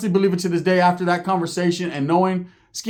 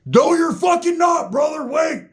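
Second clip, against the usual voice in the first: high, drawn-out shouted protest; imitated yell from upstairs; 11 semitones higher, slower than usual. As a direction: holler it high to someone downstairs. Roach yells from upstairs to wait.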